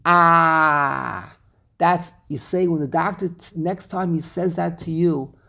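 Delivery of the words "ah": long, open-mouthed "aaah"; mock check-up "aah", held long; doctor's-exam "ah". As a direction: say a drawn-out "aah" with tongue out. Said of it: "open up your mouth, stick out your tongue and say".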